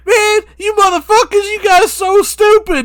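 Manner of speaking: slow, high, very loud boast. Acted. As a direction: holler it with stretched-out words. Acting [name] boasting.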